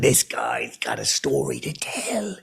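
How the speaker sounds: a lightly voiced friend, slow and low; impression of Staith. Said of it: "I'd do the impression".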